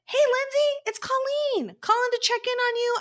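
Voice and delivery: bright, high, eager greeting; excited. Retold or imitated. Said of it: "I was excited".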